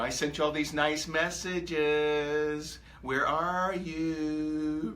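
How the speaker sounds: very slow, whiny mock complaint; mock needy whine. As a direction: drag it out like a hurt, ignored friend. Mocking the needy follower.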